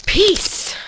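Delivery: big high yell of peace; sign-off shout. Real, not acted. Vlogger yelling goodbye to his viewers, loud high shout. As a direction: belt it out as a goodbye.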